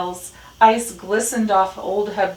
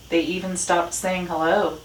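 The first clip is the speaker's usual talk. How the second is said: ordinary delivery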